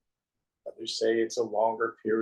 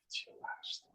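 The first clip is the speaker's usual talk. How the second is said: soft, unvoiced whisper; 17 dB quieter than usual. Real, not acted. Teacher confiding to listeners, hushed whisper. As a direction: let it out as a secret.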